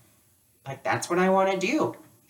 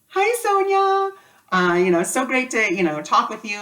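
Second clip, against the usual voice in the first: starstruck hello; 7 semitones higher than usual. Retold or imitated. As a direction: gush hello to your hero. Nervous greeting to her idol.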